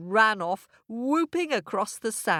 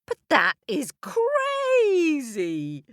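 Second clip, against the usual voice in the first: incredulous; 9 semitones higher, 4 dB louder, slower than usual. Acted. Coyote protests the absurd idea.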